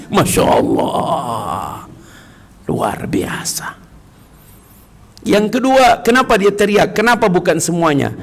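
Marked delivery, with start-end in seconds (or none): 0.1-3.8 low, slow hushed marvel; awed whisper. Real, not acted. Preacher marvelling quietly to the congregation. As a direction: breathe out the wonder softly.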